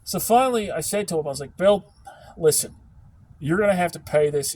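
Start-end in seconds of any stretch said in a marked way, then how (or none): 1.6-4.5 raised, firm voice laying down the law; firm telling-off. Retold or imitated. Retelling how he told off the rude customer, firm and loud. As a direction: put the rude caller in his place.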